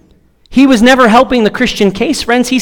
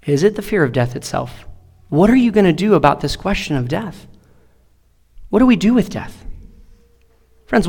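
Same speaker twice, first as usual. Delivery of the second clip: hushed, much softer preaching, pausing long between questions; soft pastoral preaching; 3 semitones lower, 5 dB quieter than usual. Acted. Pastor quietly asking the congregation their fears, soft preaching. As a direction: draw them in gently, intimate and searching. Easter sermon quiet turn.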